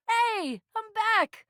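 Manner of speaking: high, happy hello; excited greeting. Acted. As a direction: sing out that you're back. Announces return to friend.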